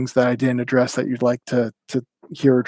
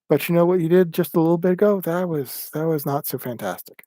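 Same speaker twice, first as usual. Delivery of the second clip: higher, friendly coaxing tone; gentle nudge; 5 semitones higher than usual. Retold or imitated. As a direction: ease him toward doing better, kindly. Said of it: "that just kind of gently pushes".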